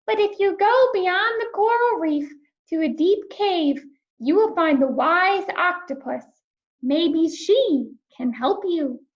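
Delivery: high, gentle starfish voice; a kindly sea creature explaining. Acted. Starfish answers lonely Rainbow fish, high and gentle.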